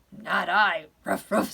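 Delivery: loud refusal with gruff little barks; storybook dog. A narrator's reading. Dog turning down the hen, loud and doggy. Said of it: "said the dog".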